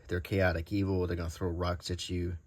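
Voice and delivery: usual speaking voice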